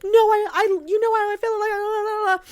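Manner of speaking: very high, whimpering imitation; mock tearful protest. She protests on the bed while crying, mock-tearful high voice. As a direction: babble tearfully in a squeaky high voice. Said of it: "while she's crying".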